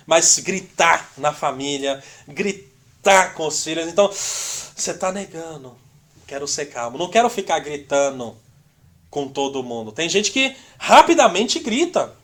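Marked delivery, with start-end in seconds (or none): 4.1-9.9 calm self-talk. Retold or imitated. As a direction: sound like someone choosing calm. Voicing a calm believer.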